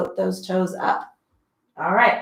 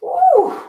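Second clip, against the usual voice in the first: very high, loud woo; celebratory whoop; triumphant woo; 9 dB louder than usual. Real, not acted. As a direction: cheer as the set ends. Finishing the last rep.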